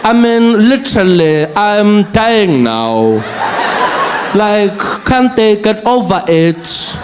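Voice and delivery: bored, sassy drawl, slow; valley-girl sass, lightly done; sassy eye-roll. Retold or imitated. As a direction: brush them off like a sulky teen. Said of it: "And he's like".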